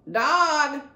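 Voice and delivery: loud, high croaking squawk; parrot croak. A narrator's reading. Parrot landing on the dog's head and squawking. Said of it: "she croaked".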